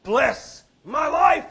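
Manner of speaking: "Bless my life" cried high, loud and drawn out; hugely emphatic cry on "Bless my life"; triple emphatic. Acted. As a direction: stretch each word of "Bless my life" into a shouted plea. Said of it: "Double and triple emphatic".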